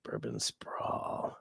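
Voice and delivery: very low, very slow whispered savouring; drawn-out whispered tryout of a favourite new phrase; low croaky whisper. Shown as a demonstration. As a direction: breathe the phrase out slowly, savouring it. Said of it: "I've gotta work that into conversation".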